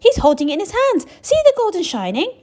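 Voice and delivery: high and loud; crowd shout. Acted. Crowd voice points out the golden shine, loud. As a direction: call out to others.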